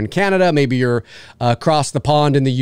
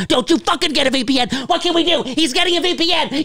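high, comically alarmed voice; mock outrage; 12 semitones higher, faster than usual. Streaming bosses alarmed at a VPN, mock outrage. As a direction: protest in a put-on high panic. Acts out panicked streaming service.

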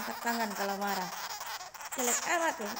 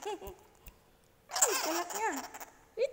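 high cooing tease; 8 semitones higher, much slower than usual. Acted. Cooing at angry little pet.

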